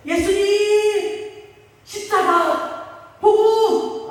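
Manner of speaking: 높고 크게 느리게 외치는 기도; 침대를 붙잡고 목청껏 외치듯. Retold or imitated. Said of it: "몇 번을 외쳤대". (환자를 붙잡고 높고 크게 외치는 기도)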